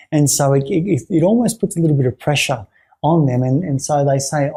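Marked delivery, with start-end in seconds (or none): none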